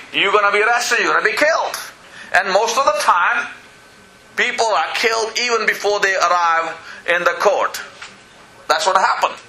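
ordinary talk, nothing marked

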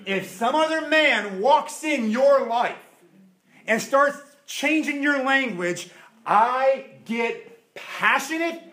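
slow, emphatic preaching build; impassioned preacher slowing for weight. Acted. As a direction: lean into each "I get".

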